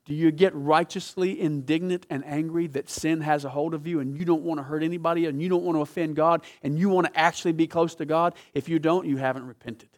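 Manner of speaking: ordinary talk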